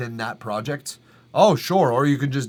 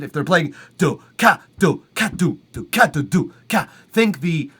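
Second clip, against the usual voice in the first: beatboxed kick snare; 3 semitones higher, 5 dB louder than usual. Shown as a demonstration. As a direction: sing the drum pattern do ka. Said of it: "Think like a drummer".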